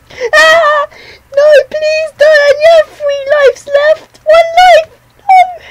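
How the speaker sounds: high, loud panicked shrieks; desperate screaming. Real, not acted. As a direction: scream and beg for your last life. Caught by a killer, screaming.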